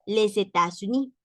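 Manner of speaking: les États-Unis with the S linked as Z; liaison in les États-Unis. Shown as a demonstration. Teacher modelling les États-Unis for her students. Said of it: "así se pronuncia".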